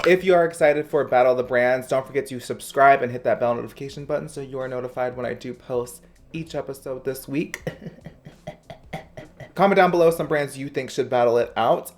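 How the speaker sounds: bright, loud presenter energy; high-energy YouTuber host read; upbeat vlog intro. Acted. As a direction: hype up the new series for viewers. Outro call to action.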